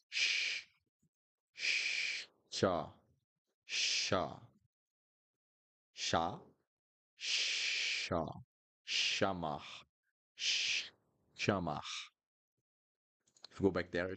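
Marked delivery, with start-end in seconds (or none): 0.1-8.3 sh hissed out long; Portuguese ch. Shown as a demonstration. Teacher shows learners the ch sound as sh. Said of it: "what I've done with my mouth". 8.9-11.8 chamar with ch as sh; Portuguese ch. Shown as a demonstration. Teacher models chamar, ch said as sh. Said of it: "that's the sound of C H".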